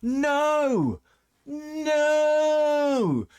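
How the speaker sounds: high, loud drawn-out no; long exasperated NO; emphatic no. Real, not acted. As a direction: shut it down flat. Shutting down the excuse.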